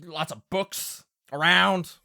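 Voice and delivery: wary Southern Pa. Acted. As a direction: warn them like a suspicious country pa. Game master plays Pa.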